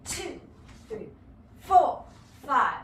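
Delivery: rhythmic teacher count, four punched loud; dance count-in. Shown as a demonstration. Dance teacher counting the class in, loud on four. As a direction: count the class in with a driving beat. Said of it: "getting out there".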